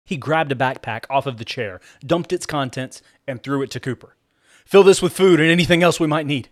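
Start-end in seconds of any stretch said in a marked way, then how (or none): 4.6-6.5 loud, raised urgent order; commanding someone in a hurry; demanding. A narrator's reading. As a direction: demand it forcefully, pitched up. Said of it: "he demanded".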